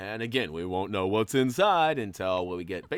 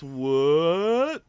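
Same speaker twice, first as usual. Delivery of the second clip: surprised; 7 semitones higher, 11 dB louder than usual. Real, not acted. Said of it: "surprised exclamation".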